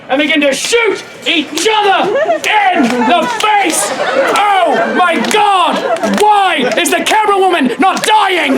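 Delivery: high, shouted, stretched-out outcry; mock frantic. A narrator's reading. Storyteller over a staged arrow battle, mock frantic. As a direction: narrate the carnage in hammed-up alarm.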